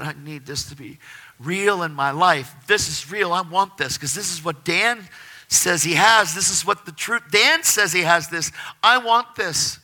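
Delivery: loud, rising plea to God; impassioned plea. Retold or imitated. Man praying to God before bed, impassioned plea. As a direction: beg with building force and volume. Retold desperate prayer to God.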